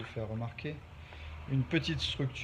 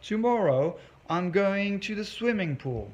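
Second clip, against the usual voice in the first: higher-pitched, put-on chatty English; acted casual announcement; 6 semitones higher than usual. Teacher pretending to share tomorrow's plans, acted chatty announcement. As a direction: play an example line, pitched up and drawn out at the end. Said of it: "je vous dirai".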